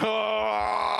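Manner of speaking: high, theatrical outburst; drawn-out wail. Pretend. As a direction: cry out at length. Outburst after bleak remark.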